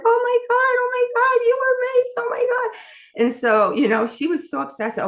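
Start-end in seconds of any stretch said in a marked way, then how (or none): 0.0-2.8 very high and loud frantic wail; panicked grandma. Retold or imitated. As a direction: play the horrified grandmother losing it. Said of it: "And she's like".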